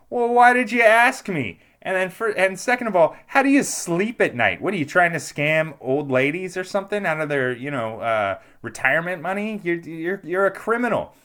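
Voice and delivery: loud, high-pitched mock outrage; indignant confrontation. Telling off a phone scammer, indignant.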